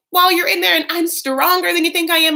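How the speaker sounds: high, loud put-on threat; mock outburst. Wife threatening Michael with divorce, mock outburst. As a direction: fling it out in hammed-up upset. Imitates wife's defiant threat.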